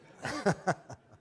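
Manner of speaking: short real laugh at the punchline; preacher laughs at his own story; laughing at own joke. Real, not acted. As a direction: chuckle after the joke.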